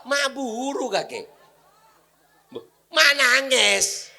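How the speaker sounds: high, slow pretend wail; mock crying child. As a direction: whimper like a child. Imitating crying grandchild.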